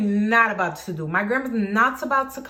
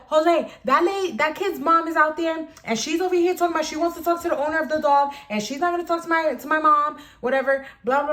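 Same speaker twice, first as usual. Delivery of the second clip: fast, higher and louder, fuming; mom's fed-up rant; 7 semitones higher, 4 dB louder than usual. Retold or imitated. Mom bursting in to tell Jose, fast fuming rant. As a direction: rush it out, indignant and exasperated. Angry mom storms inside.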